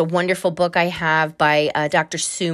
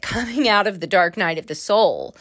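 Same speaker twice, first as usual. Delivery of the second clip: ordinary talk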